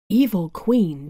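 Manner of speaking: ordinary delivery, nothing marked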